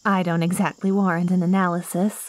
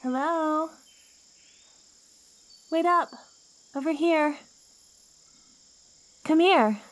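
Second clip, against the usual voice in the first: high, carrying calls to someone far off; calling out; 8 semitones higher than usual. Acted. Plant woman calling to a lost traveler in the jungle, calling out. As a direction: call out to catch their attention.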